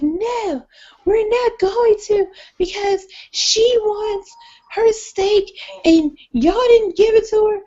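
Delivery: put-on high, loud, slow drunken protest; rowdy diners. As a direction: argue loudly like tipsy diners. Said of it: "they're like".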